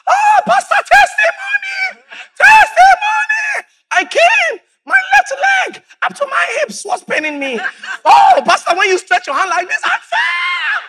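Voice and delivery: very high, shouted, laughing testimony; ecstatic testimony. Retold or imitated. Healed woman testifying to the pastor, high excited shout. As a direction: squeal it out with joy.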